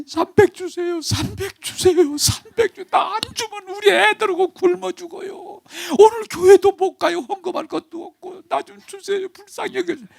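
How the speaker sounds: high, pleading wail of a desperate beggar; begging the bank clerk in a mock whining falsetto; whiny begging. Preacher mocks a begging customer, whiny high-pitched plea. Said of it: "막 징징 울어가면서 소리 지르면서".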